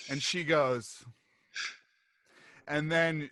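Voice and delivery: ordinary talk, nothing marked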